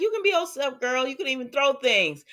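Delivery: high, bubbly cheer of encouragement; hyped-up girlfriend. Retold or imitated. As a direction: pump her up like a best friend, pitched high. Friend cheering Trina on.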